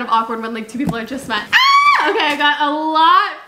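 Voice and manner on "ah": sudden high, loud shriek of glee; thrilled squeal opening a parcel; excited shriek. Real, not acted. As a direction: let out a delighted scream.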